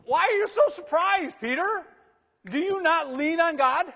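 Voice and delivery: high, loud impassioned question; voicing Jesus. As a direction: preach Jesus's words to Peter with fire. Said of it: "That's what Jesus said to Peter".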